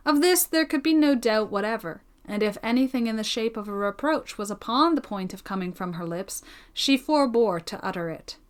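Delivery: ordinary delivery, nothing marked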